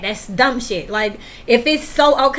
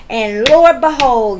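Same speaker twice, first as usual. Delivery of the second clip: slow loud exclamation; 6 dB louder, slower than usual. Real, not acted.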